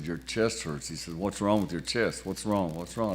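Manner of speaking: a concerned doctor suddenly gets serious with his patient, gravely. Retold or imitated. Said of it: "got the boy real serious".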